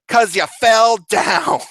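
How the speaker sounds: high, loud, slow retort; blunt nurse. Retold or imitated. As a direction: answer like a fed-up nurse. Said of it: "she's like".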